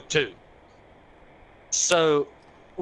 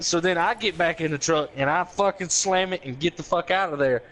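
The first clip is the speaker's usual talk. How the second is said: ordinary talk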